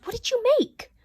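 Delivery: ordinary delivery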